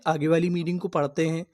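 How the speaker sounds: ordinary talk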